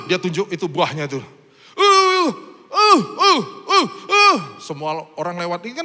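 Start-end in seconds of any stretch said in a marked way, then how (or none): 1.8-4.4 loud high pleading grunts; urgent grunts asking for the fruit; imitating a mute man. Preacher acts the mute man begging, loud high grunts. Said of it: "saya hanya untuk nyontohin".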